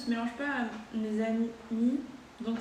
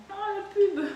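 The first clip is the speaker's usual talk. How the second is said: laughing; 10 semitones higher than usual. Real, not acted. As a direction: laugh out the words.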